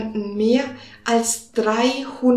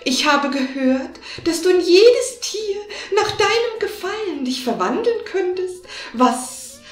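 higher, sly cat voice; 3 semitones higher than usual. Acted. Cat flattering the wizard to trick him, sly and higher. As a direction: play the clever cat, a bit higher.

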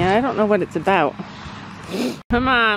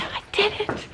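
breathy triumphant whisper, higher; proud whisper; 10 semitones higher, 7 dB quieter than usual. Real, not acted. Proud whisper to herself after catching her horse. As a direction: whisper the win to yourself.

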